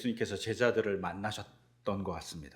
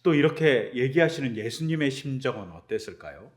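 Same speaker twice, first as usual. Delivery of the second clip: usual speaking voice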